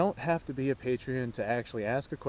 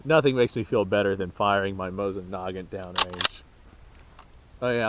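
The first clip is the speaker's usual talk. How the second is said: normal talking voice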